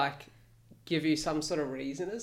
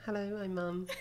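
imitating mum's angel voice; 4 semitones higher, 4 dB quieter than usual. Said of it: "Little angel voice".

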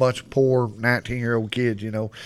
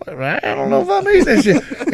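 doubtful buddy. Retold or imitated. Buddy refusing the meat.

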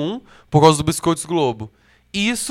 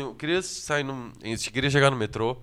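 timid lost tourist; 4 semitones lower, 9 dB quieter than usual. Retold or imitated. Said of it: "eu falo assim". (turista tímido pedindo o metrô, bem baixinho e devagar)

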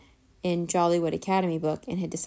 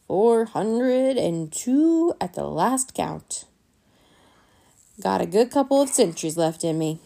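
put-on slow, louder creaky old-man voice; old grandfather; 5 dB louder than usual. A narrator's reading. Boy imitating his old vampire grandfather, slow and loud. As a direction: stretch it out like an ancient grandpa. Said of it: "best impersonation of his grandfather's voice".

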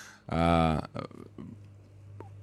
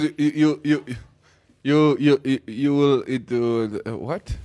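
higher voice tripping over repeated words; stammering president imitation; 6 semitones higher, slower than usual. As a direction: stumble through it like a flustered speaker. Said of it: "he goes, he goes".

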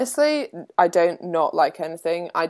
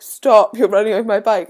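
mildly raised, louder cry to stop; retold shout; 4 semitones higher, 6 dB louder than usual. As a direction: call out a warning, lightly re-enacted. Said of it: "like, shouting to stop".

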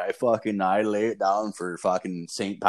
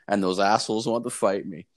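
ordinary talk, nothing marked

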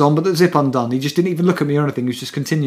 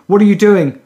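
retold shout; 5 semitones higher, 7 dB louder than usual. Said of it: "started shouting and swearing".

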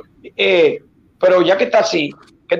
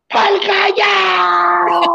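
high drawn-out party shout; rowdy yell; 9 semitones higher, much slower than usual. Retold or imitated. The storyteller hollering with the crowd, a long high yell. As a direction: stretch the cheer out slowly.

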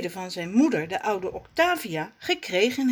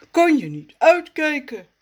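annoyed sputter; 7 semitones higher, 7 dB louder than usual. Acted. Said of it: "sputtert hij geërgerd".

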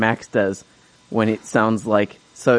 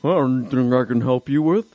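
creepy Buffalo Bill voice. Retold or imitated. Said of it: "this guy sounded exactly like that".

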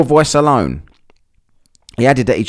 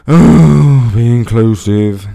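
sarcastic; 7 dB louder, much slower than usual. Real, not acted. Mocking the organisers' reasoning.

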